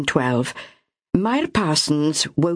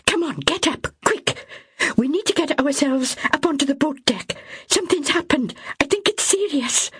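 high, urgent, hurried pleading; panicked young mother; 10 semitones higher than usual. Acted. Myra hurrying Ethel up to the boat deck, panicked. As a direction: get her moving before it's too late.

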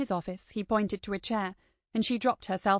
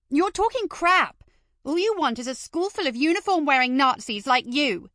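angry retort; 6 semitones higher, 6 dB louder than usual. Acted. Maddie snapping back at her teacher, angry. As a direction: let the anger spike out.

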